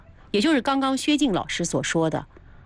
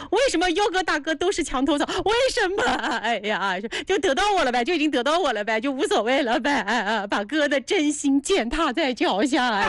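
high, hammed-up wailing lament; mock howling; 4 semitones higher than usual. Streamer lamenting that big brothers trample a sincere heart, mock howling. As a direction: wail it like a fake heartbroken sob. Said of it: "不嚎一下吗".

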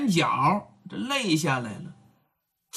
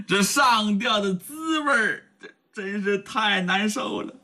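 crying; 5 semitones higher than usual. Acted. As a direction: choke up on the pain. Young man weeps telling pain. (带着哭腔诉苦)

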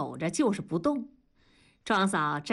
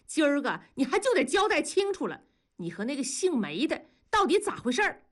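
higher-pitched angry interrogation; glaring accusation; 7 semitones higher than usual. A narrator's reading. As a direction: demand an answer, glaring. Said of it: "庄嫂就瞪着大庄说". (瞪眼质问)

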